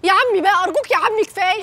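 high whining plea; pleading. Acted. Niece begging her uncle the boss to stop the docking, high and whiny.

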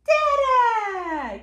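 high, loud, long Dada; big falling singsong shout; exclaimed refrain. Acted. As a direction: belt out the grand final cheer. Everyone's final shout of the refrain.

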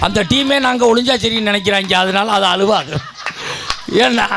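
usual speaking voice

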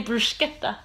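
bruschetta as "broo-SKET-ta", imitating TV cooks; mock-Italian "bruschetta" with sk; Italian-style bruschetta. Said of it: "don't they say bruschetta".

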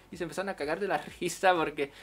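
normal talking voice

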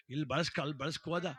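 usual speaking voice

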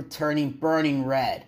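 ordinary delivery, nothing marked

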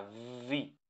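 "V" said vvvee, buzzed at the start; vibrating V. Shown as a demonstration. Teacher demands vibration from students, buzzed "V". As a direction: hold the vibration before the vowel of "V". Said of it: "tiene que haber una vibración".

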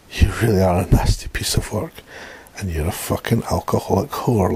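plain reading voice

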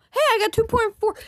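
thrilled shout about the count; excited squeal. Real, not acted. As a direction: burst out in delight. Delighted at a number.

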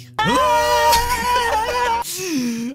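loud high laugh-scream. Real, not acted. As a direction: shriek with laughter.

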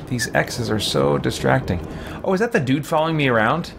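ordinary delivery

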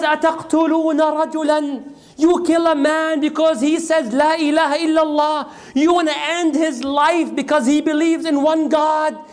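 high, anguished pleading, no real sobs; retold tearful protest. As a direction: plead as if close to tears. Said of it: "He was crying, crying".

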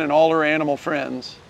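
ordinary delivery

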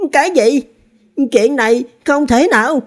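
shocked high-pitched outcry; Lý Thiên Thanh. A narrator's reading. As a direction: cry out in disbelief, voice shooting up. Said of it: "thất thanh nói". (thất thanh kêu lên, giọng cao vút)

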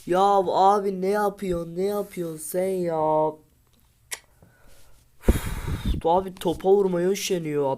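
loud, high, exasperated yelling; angry shout. Real, not acted. Gamer yelling at his lazy striker. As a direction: berate the player who won't shoot.

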